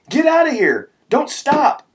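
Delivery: very high, loud urgent yell. A narrator's reading. Another buddy urging the driver to flee, yelling. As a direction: yell it to get the driver moving. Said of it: "another yelled".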